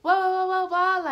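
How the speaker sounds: mock his ranting, high and loud; hammed-up babble of an angry boyfriend. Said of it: "He was going off".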